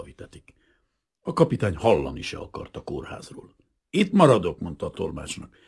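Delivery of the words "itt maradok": high, loud, determined statement; firm refusal. A narrator's reading. Captain refuses hospital to interpreter, defiant. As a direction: dig in firmly.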